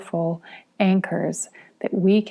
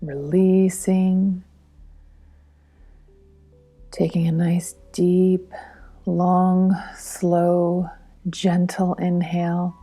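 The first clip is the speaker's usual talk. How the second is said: guided meditation. Real, not acted. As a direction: lead a breathing exercise gently and unhurriedly, phrase by phrase.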